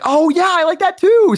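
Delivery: high, slow fake enthusiasm; a sugary put-on "too"; pretend interest. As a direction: pretend to share her taste.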